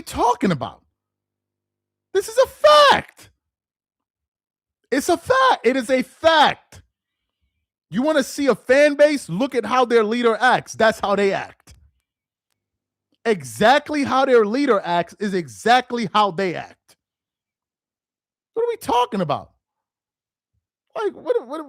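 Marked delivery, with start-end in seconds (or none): 2.1-6.8 high, slow, insistent "fact"; emphatic declaration. Real, not acted. Ranting at LeBron fans. 18.5-21.7 high, incredulous question; exasperated disbelief. Real, not acted. Incredulous at rival fans.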